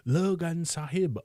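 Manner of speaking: high call into the dark; calling aloud. A narrator's reading. Kim, alone in the dark room, calls out high for his master. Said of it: "lifted up his voice and called aloud".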